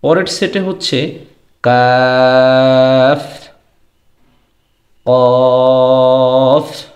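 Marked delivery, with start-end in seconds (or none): 1.6-6.8 long drawn-out কাফ then chanted কফ; Arabic letter sounds. Shown as a demonstration. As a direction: sustain each letter to show thin and thick ক. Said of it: "এখানে কাফ এবং কফ".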